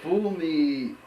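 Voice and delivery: ordinary talk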